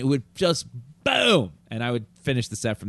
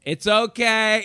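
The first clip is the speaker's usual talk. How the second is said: cruise director; 9 semitones higher, 4 dB louder, much slower than usual. Retold or imitated. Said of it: "He's like".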